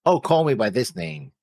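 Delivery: ordinary talk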